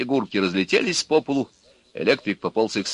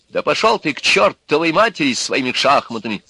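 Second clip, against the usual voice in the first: losing temper; 6 semitones higher, 5 dB louder than usual. A narrator's reading. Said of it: "Вышел из себя Назаров".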